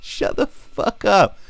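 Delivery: high, slow outburst; high-pitched, outraged outburst; exasperated outburst. Real, not acted. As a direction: snap it out in disbelief. Outraged rant.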